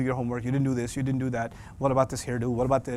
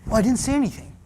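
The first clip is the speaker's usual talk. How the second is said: higher, sarcastic innocent denial; sulky denial; 7 semitones higher, faster than usual. Pretend. Teen denying to mom, mock innocent. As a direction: deny it with fake innocence. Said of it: "your mother said".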